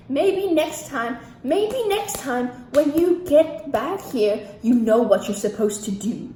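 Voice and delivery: loud, slow, drawn-out condescension; cocky, malicious. Retold or imitated. Smiling official scolds her before deportation, cocky and malicious. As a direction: imitate a cocky official rubbing it in. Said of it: "cocky, in-your-face, malicious".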